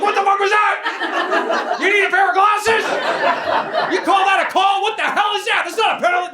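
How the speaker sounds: imitated high-pitched screaming hockey dad; hammed-up furious sideline scream. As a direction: yell outrage at the referee, voice way up. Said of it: "screaming his lungs out".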